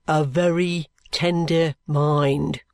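"A very tender mind" spelt out very slowly; slow, loud repeat. A narrator's reading. Bucket repeating for the deaf old man, slow and clear. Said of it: "repeated for deaf man".

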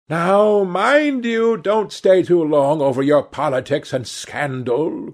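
high, loud call across the room; calling from the door. A narrator's reading. Hostess calling from the door to the men, loud high call. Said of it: "cried Lady Narborough from the door".

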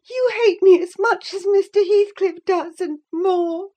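high, loud, distressed wail; weeping. A narrator's reading. Catherine feels hated by her cousin, tearful and high. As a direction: accuse him tearfully, pitched very high. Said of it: "wept Cathy, no longer disguising her trouble".

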